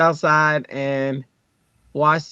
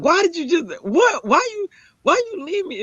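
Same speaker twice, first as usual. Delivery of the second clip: much higher, stammering protest; retold indignant complaint; 13 semitones higher than usual. Mom confronts Dre after the man passed, indignant. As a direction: squeal it like someone abandoned.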